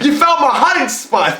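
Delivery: high-pitched comic squeal through laughter; laughing mock surprise. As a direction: play caught out, cracking up. Acting caught villain, laughing.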